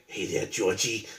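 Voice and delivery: low, slow, soft creeper voice; creepy stranger voice. Retold or imitated. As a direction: lure the kid in quietly. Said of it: "that's the creeper in the alley".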